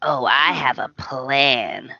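slow, low creepy whisper; sinister whisper. Retold or imitated. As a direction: whisper it like a villain. Mocking Justin's sinister plan.

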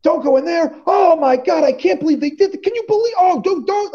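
mocking a moviegoer talking loudly through the film; loud chatty moviegoer. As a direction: imitate a talker gasping at the screen. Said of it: "like, like, loud".

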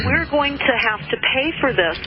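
ordinary talk

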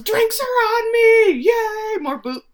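very high, loud whooping shout; acted-out jubilant cheer; celebratory yell. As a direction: yell it out to the whole bar. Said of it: "And then yells".

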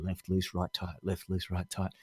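low, soft recitation to oneself; muttered self-reminder. Acted. Learner driver reminding himself on the road, muttering low. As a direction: repeat it under your breath like a memorised rule.

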